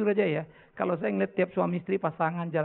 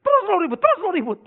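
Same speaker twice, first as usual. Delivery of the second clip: high, loud repeated mock yell; retold angry driver; 11 semitones higher, 8 dB louder than usual. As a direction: snap it twice in an acted car quarrel. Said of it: "Kita lagi marah". (meniru omelan marah, tinggi dan keras)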